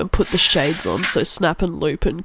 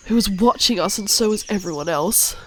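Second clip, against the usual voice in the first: ordinary talk, nothing marked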